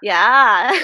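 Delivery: loud, drawn-out Minnesotan "yah"; Minnesota accent. Retold or imitated. Podcaster imitating the police chief's Minnesota accent. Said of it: "She's like".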